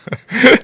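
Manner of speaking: sudden high, loud laugh; high burst of laughter. Real, not acted. Laughing at own joke about translating, loud high laugh.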